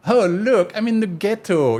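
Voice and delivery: high, haughty posh speaker. Retold or imitated. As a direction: look down your nose, posh and high. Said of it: "with a very rich accent, talking like a posh person".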